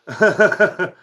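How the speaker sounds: high, loud burst of laughter; real laugh. Real, not acted. Streamer amused by a chatter's question, laughing.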